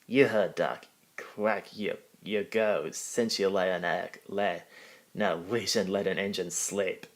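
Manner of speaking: gruff Scottish engine voice; lightly voiced Scottish engine; Scottish accent.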